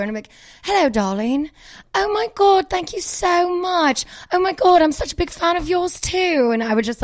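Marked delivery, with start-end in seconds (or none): 0.6-6.5 high, gushing British accent; slow, drawn-out British thanks, pitched high; Lisa Vanderpump. Shown as a demonstration. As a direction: gush down the phone as a British lady. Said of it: "as if I was Lisa and they thought it was her".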